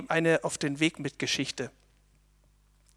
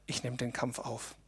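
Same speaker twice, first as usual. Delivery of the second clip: quiet resolve; 4 semitones lower, 12 dB quieter than usual. Acted.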